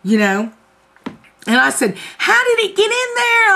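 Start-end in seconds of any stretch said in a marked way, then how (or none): 2.2-3.5 paranoid. Retold or imitated. Paranoid retelling.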